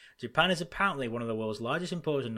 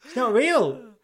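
teasing; 9 semitones higher, 5 dB louder than usual. Pretend. Teasing mock conspiracy argument.

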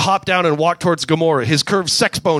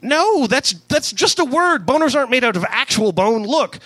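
higher, protesting space hero; Star-Lord voice; 7 semitones higher than usual. Acted. Star-Lord arguing with Rocket, higher indignant voice. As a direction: insist it's just a word.

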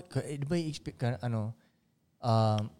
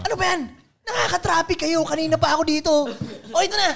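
raised pitch, fake impatience, then handing over cash; acted irritated rich man; 7 semitones higher than usual. Rich man scolds the people at the crash, hammed-up annoyance. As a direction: ham up the annoyance before paying. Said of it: "Tangina, acting mo kayo".

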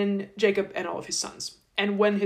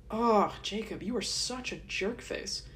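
quiet aside; 8 dB quieter than usual. Real, not acted. Aside after whispered lead-in.

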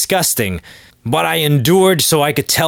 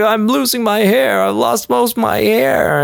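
higher, whining about losing his hair; mock whiny balding guy; 8 semitones higher than usual. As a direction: play a sad man mourning his hair. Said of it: "He's like".

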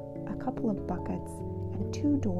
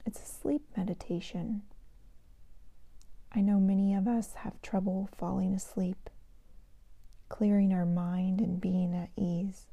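sleep meditation whisper; 5 dB quieter than usual. Shown as a demonstration. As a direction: whisper them gently toward sleep. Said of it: "I'd like to share a meditation with you today".

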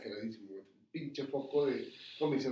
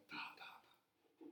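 whispered knocking; 21 dB quieter, slower than usual. Retold or imitated. Telling friends about knocks at the door: whispered knock sounds.

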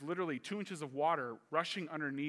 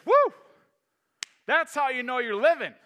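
excited guide; 8 semitones higher, 13 dB louder than usual. Retold or imitated. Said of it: "one of the guides says".

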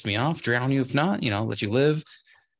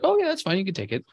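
high, sing-song mock boss voice; mock cheery boss; 10 semitones higher than usual. Mocking a boss who approves the time off, high and sing-song. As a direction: squeak it out like an overly agreeable boss. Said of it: "they would be like".

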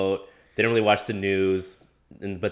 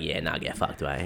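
playful mock Aussie, a touch lower and softer; Australian accent; 4 semitones lower, 7 dB quieter, slower than usual. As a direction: toss it off like a laid-back Aussie. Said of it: "They're like".

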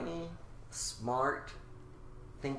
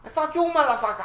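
put-on fast, high, loud Indian-accented cursing; 10 semitones higher, 5 dB louder, faster than usual. Scammer trading curses with the customer, Indian accent. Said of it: "get my Indian and Australian".

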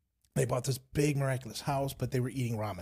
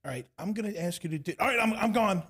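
hasty exit; 7 semitones higher, 4 dB louder than usual. Retold or imitated. Mock impatient believer rushing off.

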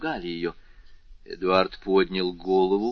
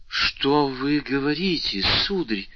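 higher, slow cry of protest; distressed exclamation; 5 semitones higher, 3 dB louder, slower than usual. A narrator's reading. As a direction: exclaim in alarm at the accusation. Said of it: "воскликнула госпожа де Вильфор".

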